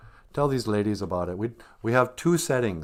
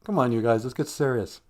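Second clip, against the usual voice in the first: ordinary delivery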